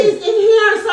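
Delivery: high, loud call to the phone; yelling across the room. Real, not acted. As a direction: call out over the noise. Landlord calls out on police call.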